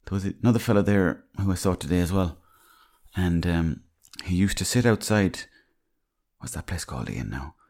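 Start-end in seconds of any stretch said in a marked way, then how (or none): 6.4-7.6 whispering a quick aside; whispered aside. Real, not acted. Whispering an aside to Ian for the pub's name. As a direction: lean in and whisper the question.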